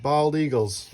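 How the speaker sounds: usual speaking voice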